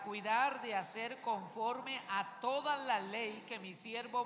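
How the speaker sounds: loud, slow reading in unison with the congregation; unison reading aloud. Shown as a demonstration. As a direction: lead the whole room through the verse, loud and slow. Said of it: "vamos a leer ese versículo todos a una sola voz". (lectura en voz alta y lenta, todos a una voz)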